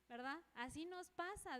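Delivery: usual speaking voice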